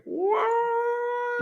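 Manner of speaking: a very high squeak of disbelief; stunned what. Retold or imitated. Wondering if the kiss happens now, very high stunned squeak. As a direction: pop it up high in shock. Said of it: "it's just like".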